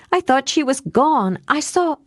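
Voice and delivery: high, urgent outburst that breaks off; high-pitched emotional rush; overwrought relief. A narrator's reading. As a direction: blurt it out, overcome at finding her alive. Distraught over dog she believed killed.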